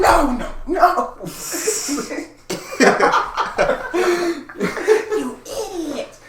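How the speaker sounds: high, loud, very slow laughing put-down; hammed-up scolding dissolving into laughter; laughing mock scorn. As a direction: giggle through a mock insult. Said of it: "I was like".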